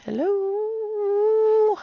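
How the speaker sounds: high, soft, long-held calling; singsong hello. Pretend. Calling to the unfocused camera, high singsong hello. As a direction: draw out the last vowel playfully. Calls out while camera refocuses.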